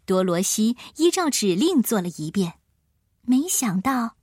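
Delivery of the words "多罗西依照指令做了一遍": ordinary delivery, nothing marked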